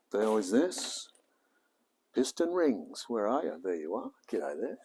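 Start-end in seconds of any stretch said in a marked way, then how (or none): none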